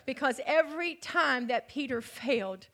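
usual speaking voice

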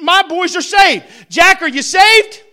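proud father standing firm before the church; a father's loud, slow vow; firm declaration. Retold or imitated. Dad declares sons saved in church.

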